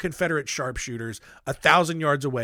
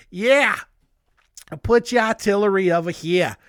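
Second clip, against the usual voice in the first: put-on loud, higher, botched Southern accent; muddled put-on accent; 5 semitones higher, 6 dB louder than usual. General placing artillery, badly faked Southern accent. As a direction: ham up an officer's unplaceable Southern-ish accent. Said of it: "I don't know what accent it is".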